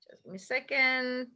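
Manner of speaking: whispered aside while checking. Real, not acted. Teacher whispering an aside to herself. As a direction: drop to a whisper to self.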